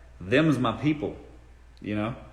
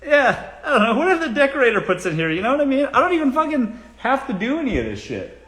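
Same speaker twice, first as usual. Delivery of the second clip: much higher, louder hammed-up rich snob; mock rich diva; 10 semitones higher, 4 dB louder than usual. To viewers asking about candles, hammed-up rich diva voice. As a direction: play the pampered celebrity who has staff.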